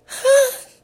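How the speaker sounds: very high squeaky mock huh. The skinny jeans kid answering confused in a squeaky voice. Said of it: "He said".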